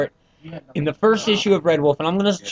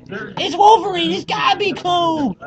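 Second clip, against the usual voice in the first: mock outrage; 12 semitones higher than usual. Mocking fan reasoning.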